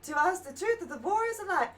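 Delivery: bad Southern accent. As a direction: greet them like an overly polite Southern host. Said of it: "It just went really weirdly Southern".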